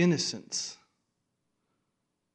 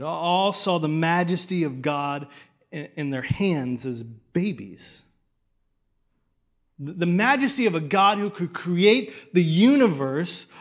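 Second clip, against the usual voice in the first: rising, louder preacher's proclamation; building preaching; 3 semitones higher, 7 dB louder than usual. Acted. Pastor declaring God's majesty to the congregation, growing in force.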